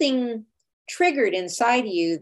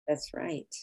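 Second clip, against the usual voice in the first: whisper; 6 semitones lower, 12 dB quieter than usual. Real, not acted.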